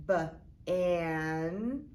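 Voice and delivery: B as "buh", then "an" stretched; B as a letter sound before a held "an". Shown as a demonstration. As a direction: segment it: "buh", pause, drawn-out short-a "an". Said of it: "the A makes a short sound".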